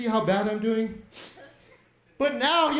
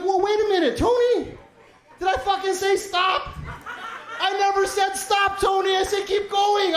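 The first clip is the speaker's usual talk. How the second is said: mock indignant; 5 semitones higher than usual. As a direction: scold the waiter for stopping, hammed-up. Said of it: "I'm like".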